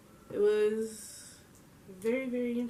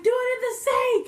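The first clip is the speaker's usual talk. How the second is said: high, loud urgent order; frantic mock yell; 13 semitones higher, 6 dB louder than usual. Friend orders the puking girl to the sink, frantic mock shout. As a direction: shriek it as a panicked instruction, acted.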